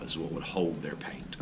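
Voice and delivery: whispered aside. Real, not acted. Aside while pointing at palette.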